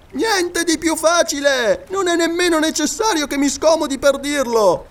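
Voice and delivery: high excited scholar calling down from a tree; Paganel's voice. Acted. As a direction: play the absent-minded geographer, pleased with himself.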